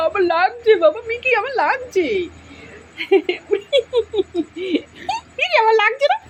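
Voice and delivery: high, laughing through the words; laughing protest. Real, not acted. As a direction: squeal with laughter as the dog nips. Laughing while pet bites.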